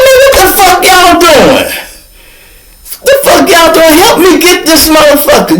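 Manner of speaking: high-pitched, indignant call for help; exasperated yelling. Retold or imitated. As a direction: act out yelling at idle bystanders. Retold yelling at officers for help.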